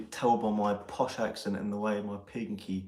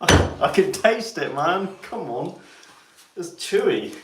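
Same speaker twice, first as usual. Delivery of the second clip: disgusted outcry; 8 semitones higher, 9 dB louder than usual. Real, not acted. Disgusted at a smell.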